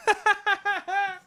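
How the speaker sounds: high, giggly cackle; tickled high laughter at your own bit; real laugh. Real, not acted. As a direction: burst out laughing mid-story. Comedian laughs at own impression.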